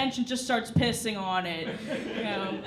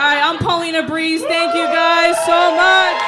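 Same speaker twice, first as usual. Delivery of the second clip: shouted sign-off; 3 semitones higher, 11 dB louder than usual. Real, not acted. Comedian shouting her name and thanks over applause. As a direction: call it out to the crowd.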